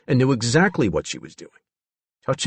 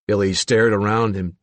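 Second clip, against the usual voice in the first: plain reading voice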